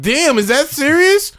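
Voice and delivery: high, drawn-out incredulous question; mock disbelief. Co-host replaying his reaction to the TV show, mock incredulous. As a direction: stretch it out way up high in mock shock. Retelling reaction to absurd TV moment.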